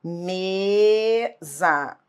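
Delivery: Mesa split slowly into a long ME, then ZA; syllable by syllable. Shown as a demonstration. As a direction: separate the syllables of Mesa with a held first one. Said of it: "separar as sílabas da palavra". (Mesa separada em sílabas, ME longo e depois ZA)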